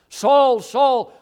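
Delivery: SAUL, SAUL, both hit hard and falling; emphatic repeated call. Retold or imitated. Preacher quoting the voice Saul hears, emphatic. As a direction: call out "Saul, Saul" with weight on each name. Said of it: "for emphasis".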